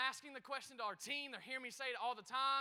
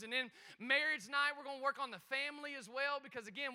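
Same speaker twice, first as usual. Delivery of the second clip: ordinary talk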